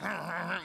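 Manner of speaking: rumbling mouth-made sound; imitated noise. Praying person showing God the problem, imitated noise. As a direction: mimic a droning noise. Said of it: "it sounds like".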